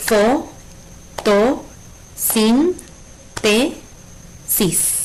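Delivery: "Fo, to, sin, te, sis" as separate beats with long pauses; syllable by syllable. Shown as a demonstration. Teacher separating the word, "Fo, to, sin, te, sis" syllable by syllable. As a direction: chop "Fo, to, sin, te, sis" into five isolated syllables. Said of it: "La separamos en sílabas".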